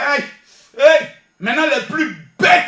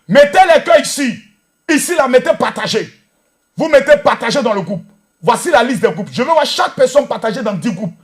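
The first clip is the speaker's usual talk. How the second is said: loud exhortation; 5 dB louder than usual. Real, not acted. Exhorte les spectateurs à partager.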